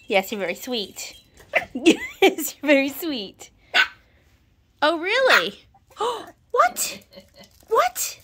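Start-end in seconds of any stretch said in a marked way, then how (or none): 1.7-5.6 high, loud cooing, laughing into it. Real, not acted. Owner praising her puppy, high gushing baby talk. 6.0-8.2 gasp, then a very high playful "What?"; mock surprised gasp. Owner pretending shock at her puppy, high mock surprise.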